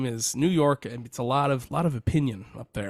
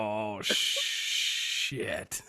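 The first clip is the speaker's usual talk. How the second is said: breathy, hushed oh shit; mock dismay; 3 semitones lower, 4 dB quieter than usual. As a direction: voice the teammate's shock. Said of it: "the other guy's like".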